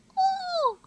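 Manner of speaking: squeaky admiring ooh; high ooh. Real, not acted. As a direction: coo at it.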